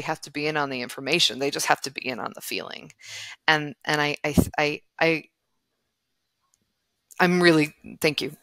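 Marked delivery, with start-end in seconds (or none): none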